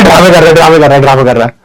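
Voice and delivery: quick mock-scolding mom; mom's dismissal. Mother dismisses the son's crying, quick mock scold. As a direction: brush it off as drama, fast. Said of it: "mummy कह रहा है".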